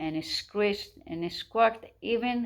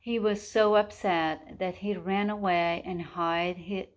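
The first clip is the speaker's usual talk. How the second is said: usual speaking voice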